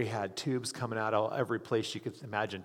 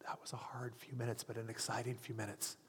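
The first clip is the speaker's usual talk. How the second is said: hushed; 9 dB quieter than usual. Real, not acted. Moved recalling the prayer.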